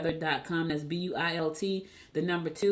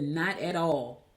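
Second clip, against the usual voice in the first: slow flat reply; much slower than usual. Retold or imitated. Nephew's answer retold.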